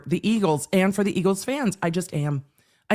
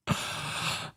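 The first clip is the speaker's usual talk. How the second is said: dreamy sigh; 11 dB quieter than usual. Real, not acted. Overwhelmed by halftime outfit.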